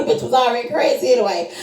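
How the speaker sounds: normal talking voice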